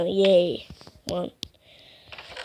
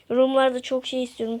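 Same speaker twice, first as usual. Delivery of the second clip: ordinary delivery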